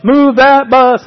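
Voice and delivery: high, loud and slow chant; a drawn-out announcing shout; called out like the show. Retold or imitated. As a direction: belt the reveal like a crowd. TV makeover reveal call.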